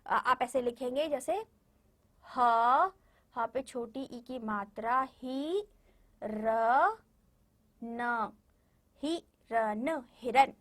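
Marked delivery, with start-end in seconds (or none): 2.2-10.5 slow, ह, ही, र, न spoken one by one before हिरन; sounding out letters. Shown as a demonstration. Teacher showing children how to sound out हिरन while writing. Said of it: "इस तरीके से बोल बोल के लिखना".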